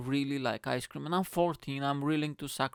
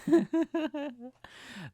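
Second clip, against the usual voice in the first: high mock laugh; 14 semitones higher than usual. Laugh in put-on voice.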